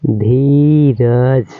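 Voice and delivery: धीरज said slowly, with the धी held long; long drawn dhee. Shown as a demonstration. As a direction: stretch out the धी in धीरज. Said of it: "तो धी का उच्चारण करेंगे".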